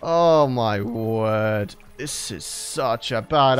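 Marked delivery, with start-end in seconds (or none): none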